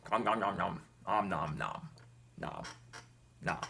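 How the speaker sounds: imitated eating noises, trailing into spaced-out noms; munch on the noms, slowing to single bites; mimed munching. Vlogger imitating officers eating spaghetti at home, mock munching. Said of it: "They're just eating spaghetti now".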